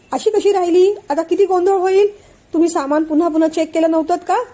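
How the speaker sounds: high, loud, alarmed questioning; shocked dismay. Acted. Aunt hears the bag was left behind, high, loud shock.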